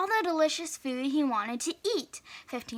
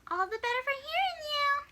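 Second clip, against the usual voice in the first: much higher, sweet put-on granny voice; wolf pretending to be grandma; hammed-up old lady voice; fake grandma voice; 12 semitones higher, 4 dB quieter, faster than usual.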